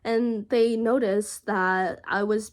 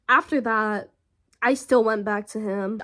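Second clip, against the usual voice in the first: ordinary talk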